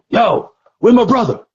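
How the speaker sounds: shouted high and loud, demanding; yelling. Retold or imitated. Nelson banging on the car.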